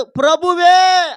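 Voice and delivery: ప్రభువే drawn out slowly, loud and high on the final ఏ; stress on ప్రభువే. Shown as a demonstration. Preacher stressing the word for his congregation, ప్రభువే slow and loud. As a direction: lean hard on the ఏ of ప్రభువే. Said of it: "అన్నాడు".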